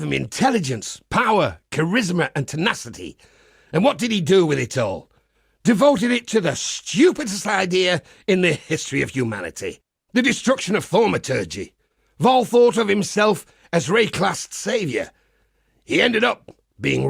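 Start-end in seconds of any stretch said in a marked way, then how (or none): none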